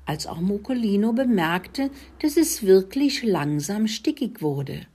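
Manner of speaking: ordinary delivery, nothing marked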